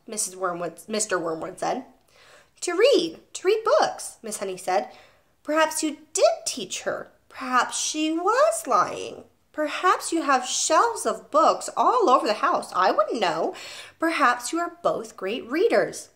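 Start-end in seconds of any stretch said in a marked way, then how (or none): 2.6-4.2 high, emphatic repetition; insistent teacher. A narrator's reading. Teacher insisting to the father, emphatic. 5.4-16.1 slow, high, pointed irony; sarcastic teacher. A narrator's reading. Teacher mocking the parents' reading habits, sarcastic.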